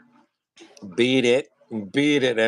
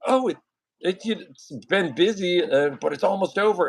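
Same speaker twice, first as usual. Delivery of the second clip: tired postal clerk; 5 semitones higher than usual. Retold or imitated. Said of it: "she just goes".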